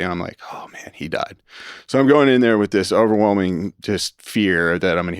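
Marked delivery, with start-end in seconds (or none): 0.5-1.4 faint whispered dread; a hushed, stricken whisper. Retold or imitated. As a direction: barely breathe the words. Dreading grandfather's death.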